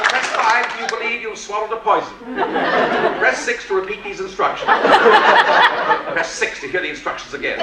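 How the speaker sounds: cheerful robot listing emergencies; automated voice. Retold or imitated. Emergency room recording options.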